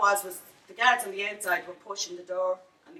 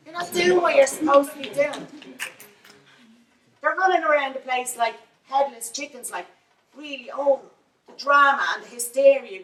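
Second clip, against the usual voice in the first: loud, high, furious outburst; angry rant; 6 semitones higher, 9 dB louder than usual. Real, not acted. Protester railing at the councillor over stolen money, loud and furious. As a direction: raise the voice and let the anger pour out.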